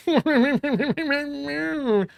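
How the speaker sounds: high-pitched mocking gibberish noise; high mock blathering; mocking babble. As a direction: jeer with a whiny, wordless imitation.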